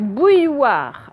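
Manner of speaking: BOUILLOIRE slow, with "ouille" clearly drawn out; sounding out. Shown as a demonstration. Teacher helping pupils spell, slow sounded-out BOUILLOIRE. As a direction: stretch BOUILLOIRE so each sound is heard. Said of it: "j'entends bien que j'ai le son ouille".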